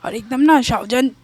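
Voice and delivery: plain reading voice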